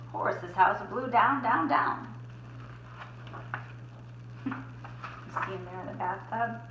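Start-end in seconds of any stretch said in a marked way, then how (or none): none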